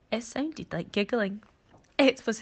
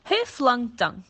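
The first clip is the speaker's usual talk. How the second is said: higher, louder screech; screeching question; 7 semitones higher, 7 dB louder, slower than usual. Acted. Monkey screeches at python and crocodile, a loud high screech. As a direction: shriek it at both of them.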